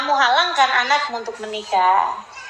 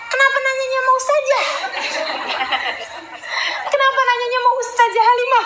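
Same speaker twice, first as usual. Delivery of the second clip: incredulous; 10 semitones higher, faster than usual. Real, not acted. Puzzled at questioner's choice.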